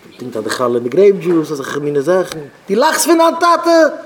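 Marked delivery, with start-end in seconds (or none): 2.7-3.9 high, slow, stretched-out cry; acted outrage, pitched far up; indignant rebuke. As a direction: scold in a drawn-out high voice. Acted rebuke for laughing at father.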